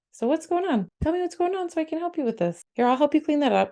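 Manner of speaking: calm soothing parent. Shown as a demonstration. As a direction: coax the kid to open up. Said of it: "saying something more along the lines of".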